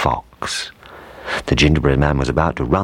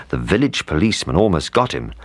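usual speaking voice

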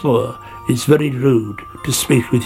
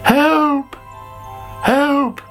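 high-pitched cry of alarm; calling for help; 14 semitones higher than usual. A narrator's reading. Sammy feels strange and calls for help, high panicked cry. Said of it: "called Sammy".

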